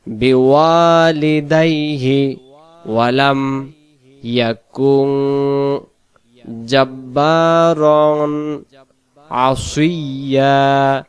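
slow drawn-out recitation. Shown as a demonstration. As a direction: recite slowly and measured. Recites next verse slowly.

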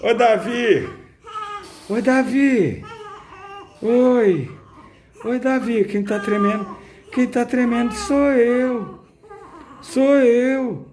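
loud high cheerful cooing. Real, not acted. Nurse cheering up baby Davi, high loud baby talk.

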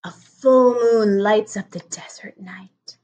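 slow hushed whisper of the night scene. Acted. Mom reading the night page to her son, slow whisper. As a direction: breathe the words slowly, hushed, like the moon is rising. Mom reads bedtime picture book.

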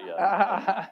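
laughing at his own joke. Real, not acted. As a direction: deliver the punchline laughing. Joke about British robot voice.

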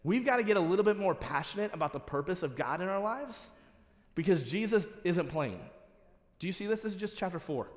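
high, loud, urgent sermon; passionate preaching. Acted. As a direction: fire up the congregation.

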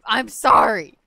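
mock loud apology. Mock apology, then "not actually.

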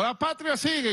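high, rallying slogan answer; called-out reply. Retold or imitated. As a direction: re-enact calling back to the crowd. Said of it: "Y yo le digo".

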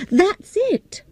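high, bright burst of discovery; excited realization. A narrator's reading. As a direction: exclaim the idea with delight. Sudden idea, excited.

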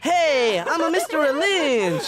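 high, loud, drawn-out mock teacher; Mister Lynch. Boy mocking teacher for coach, high blended impression. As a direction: do the teacher in the odd kid's voice. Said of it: "Mister Lynch as the other kid".

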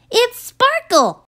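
high, thrilled exclamation; excited cry. Acted. Girl opening the box, thrilled high cry. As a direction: squeal it with delight. Lucy finds unicorn in box.

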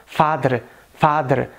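"father" with D for TH and tapped R; Indian English accent. Shown as a demonstration. Teacher contrasting with American, Indian accent. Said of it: "e no inglês indiano".

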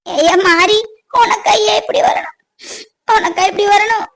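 put-on mother crying over her child; weeping lament. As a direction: wail the question through sobs. Mother weeps over injured son. (அழுது அரற்றும் குரல்)

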